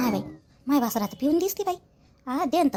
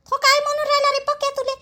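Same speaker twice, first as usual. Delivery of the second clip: indignant mother; 9 semitones higher than usual. Acted. Mother confronts son about Pakya.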